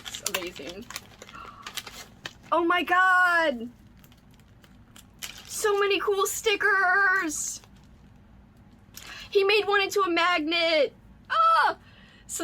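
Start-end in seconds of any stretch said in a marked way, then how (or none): 2.5-11.8 real thrilled yelling; excited shout. Real, not acted. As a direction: squeal at each new find. Finding the stickers.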